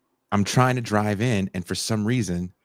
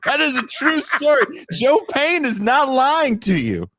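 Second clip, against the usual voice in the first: excited high insistence; 4 dB louder than usual. Real, not acted. Laughing, confirming friend's claim.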